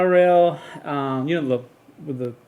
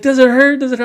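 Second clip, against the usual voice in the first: much higher, louder repeated worried question; frantic mom; 10 semitones higher, 5 dB louder, faster than usual. Retold or imitated. Frantic mother checks her bleeding son, high and loud. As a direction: fire it off like a panicked mother.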